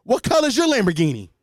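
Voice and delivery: loud, high taunting jab; Andrew Tate impression. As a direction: sneer it like a podcast bro. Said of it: "that's just my Andrew Tate impression".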